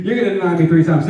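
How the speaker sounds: fast, laughing through the words. Real, not acted. Preacher retelling Jesus's warning, laughing. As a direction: chuckle out the punchline. Teller laughs, repeating the line.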